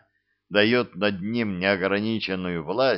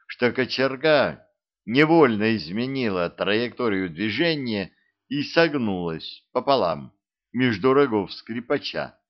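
ordinary delivery, nothing marked

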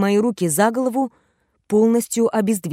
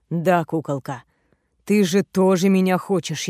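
raspy whisper. Acted. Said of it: "Хриплый шёпот сводит с ума".

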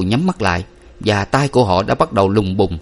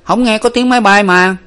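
put-on eager young boy; 12 semitones higher, 5 dB louder than usual. A narrator's reading. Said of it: "Tâm nhanh nhẩu nói".

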